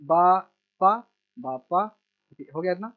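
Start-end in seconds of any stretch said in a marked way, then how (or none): 0.1-0.9 each syllable set apart. Shown as a demonstration. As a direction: sound it out piece by piece for a learner. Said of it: "half half करके".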